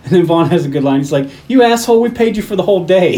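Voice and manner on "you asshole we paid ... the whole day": louder, higher mock outrage; acted indignant outburst. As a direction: throw it out like a cheated employer. Acting out angry line from film.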